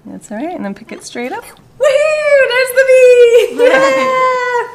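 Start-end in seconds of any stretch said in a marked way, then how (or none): 1.4-4.7 high, loud joyful whoops; thrilled shouting high and loud; excited cheering. Real, not acted. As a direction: cheer the stamp like a win. Baby's footprint stamp succeeds.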